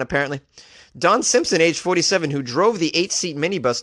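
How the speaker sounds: ordinary talk, nothing marked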